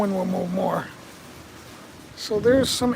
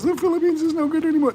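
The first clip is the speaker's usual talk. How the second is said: mocking whiner; 7 semitones higher, 5 dB louder, faster than usual. Mocking quitters' videos.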